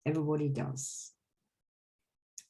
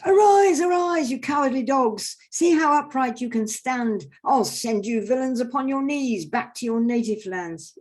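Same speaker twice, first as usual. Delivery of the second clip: very high, loud commanding shout; George shouts; 8 semitones higher, 8 dB louder than usual. Acted. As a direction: bellow the dead back up like a bully knight. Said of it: "George shouts".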